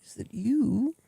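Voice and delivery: slow, hushed English villain, dropping to a whisper; English villain accent. Pretend. A film villain lecturing Mister Bronzy in a hushed English accent. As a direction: purr it like a menacing English villain. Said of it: "I want either an English accent where it's like".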